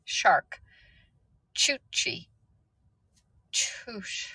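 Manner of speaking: whispered, sounding out "shark" "Chuchi"; hushed practice of a hard word. Real, not acted. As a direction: whisper the syllables to yourself. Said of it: "trying the word".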